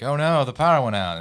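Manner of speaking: lightly put-on guide feigning alarm; tour guide's feigned dismay. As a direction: play up the fake surprise. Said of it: "he's like".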